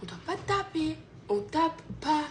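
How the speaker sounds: put-on soft, calm explaining to a child; softly and gently, to her son; gentle explaining. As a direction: reassure him gently. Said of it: "J'ai expliqué ça gentiment".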